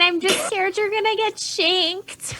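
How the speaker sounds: high, loud, hammed-up alarm; worried protest. [name] scared for a friend heading upstairs, loud high protest. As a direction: blurt it high and loud over the laughter.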